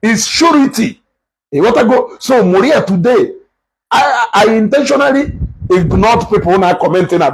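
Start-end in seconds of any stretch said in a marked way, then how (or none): 0.3-1.0 "surety" with an oo vowel and a clear middle ri; correct pronunciation. Shown as a demonstration. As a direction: say "surety" in three syllables, SHOO-ri-tee. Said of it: "real pronunciation of that word".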